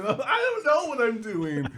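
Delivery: high, drawn-out protest, half laughing; slow, high-pitched mock cry; mock helpless wail. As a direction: wail it back as a hammed-up plea. Acted reply to being screamed at.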